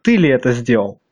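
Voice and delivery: ordinary talk, nothing marked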